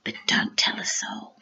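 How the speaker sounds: secretive storybook whisper. A narrator's reading. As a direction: hush it like a secret to a child. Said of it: "I'll whisper a secret".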